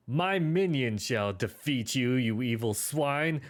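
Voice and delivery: slow, high, loud villain-style declaration; loud proclamation. Acted. Bard proclaiming to the bandits, loud and grand. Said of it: "loudly proclaims".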